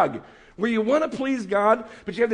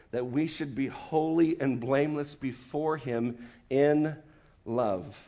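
scripture reading; 6 semitones lower, 3 dB quieter than usual. Acted. Reads Ephesians aloud.

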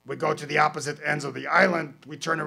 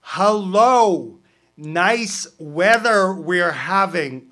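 slow, louder parrot voice; parrot greeting; 4 semitones higher, 12 dB louder, much slower than usual. Acted.

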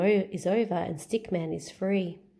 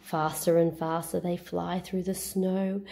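ordinary delivery, nothing marked

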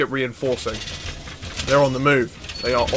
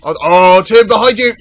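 loud, higher yell to a teammate; urgent warning shout; 6 semitones higher, 12 dB louder than usual. Real, not acted. Warning teammate Tim of an enemy behind, loud shout.

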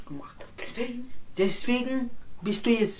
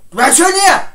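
much higher and louder order; commanding shout; 10 semitones higher, 15 dB louder than usual. Acted. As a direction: bark the command. Ordering him to stay. (befehlend gebrüllt)

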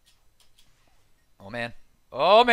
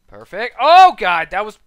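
normal talking voice

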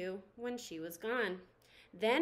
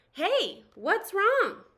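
concerned call; 7 semitones higher, 7 dB louder, much slower than usual. Acted. Forky asks panicking craft.

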